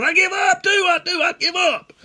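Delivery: very high, loud, insistent holler; hollered surrender back. Retold or imitated. Boy answering the old man that he gives up too, high holler. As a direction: yell it back high and fast. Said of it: "And I hollered".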